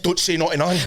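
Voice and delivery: ordinary delivery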